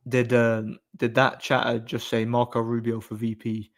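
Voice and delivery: usual speaking voice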